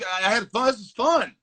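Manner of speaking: usual speaking voice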